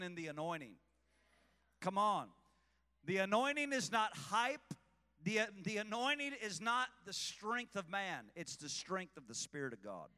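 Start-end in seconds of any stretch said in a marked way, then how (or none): none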